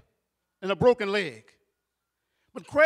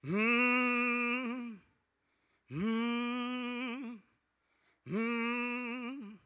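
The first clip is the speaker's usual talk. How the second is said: fading hummed moans, softer each time; low hummed moan; 10 dB quieter, much slower than usual. Retold or imitated. Aunt still at the bedside, fading hummed moans. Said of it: "my aunt would still be there".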